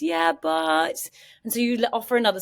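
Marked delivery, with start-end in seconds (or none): 0.0-1.1 high, loud mock objection; resistant objection. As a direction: push back on the advice, pitched up. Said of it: "And the other person's".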